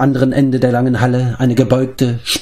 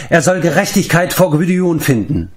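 higher, firm order; commanding; 6 semitones higher than usual. A narrator's reading. Taran to the vengeful queen, firm command. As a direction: order the queen to stand back. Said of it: "befahl Taran".

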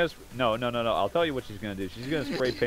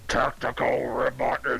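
robot callout; 4 semitones higher than usual. Pretend.

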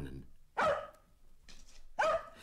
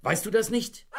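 higher and louder as the scolding builds; blustering rant; 10 semitones higher, 4 dB louder than usual. Acted. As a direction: bluster at the dog over a broken lantern. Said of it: "Aber der polterte trotzdem los".

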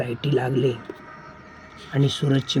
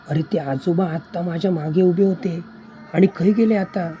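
higher, louder puzzled questioning; bewildered; 5 semitones higher, 5 dB louder than usual. Acted. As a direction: exclaim it, baffled by the disappearance. Bewildered, old man has vanished. (गोंधळून, चढ्या आवाजात विचारणे)

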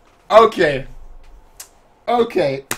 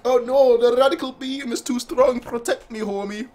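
high, hammed-up pleading; mock fearful plea; 8 semitones higher than usual. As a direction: beg for help in playful panic. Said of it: "I was like".

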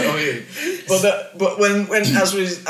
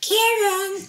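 put-on much higher childlike little-boy voice. Dad calls his baby son in a high little-kid voice. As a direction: call his name in a tiny, high kid's voice. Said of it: "I've always been able to do The Voice".

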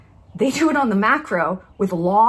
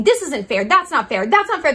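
higher-pitched mock protest, rising in pitch; imitated complaint, climbing higher; mock complaining; 6 semitones higher, 3 dB louder than usual. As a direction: parody someone protesting unfair treatment. Mocks people who sit and complain.

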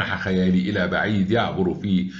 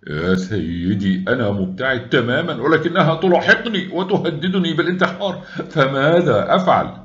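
mocking, rising much higher toward a laugh; sarcastic; 8 semitones higher, 4 dB louder than usual. A narrator's reading. Mocking his friend's advice, rising to a wry laugh. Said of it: "قلت ساخرا".

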